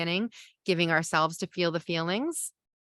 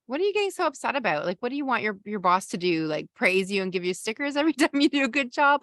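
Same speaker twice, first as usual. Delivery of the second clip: higher, fast, scoffing; dismissive partner; 5 semitones higher, faster than usual. Shown as a demonstration. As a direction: brush off the upset, fast and mocking. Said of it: "imagine your partner says".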